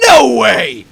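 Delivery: high, loud burst of disbelief; hammed-up astonished exclamation; mock disbelief. Delivery driver stepping back on hearing the age, exaggerated disbelief. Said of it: "a little bit of disbelief".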